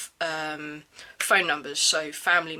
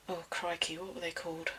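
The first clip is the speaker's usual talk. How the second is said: quiet, muttered aside; muttering to self; 12 dB quieter than usual. Real, not acted. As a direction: mutter it under your breath. Muttering to self while recalling.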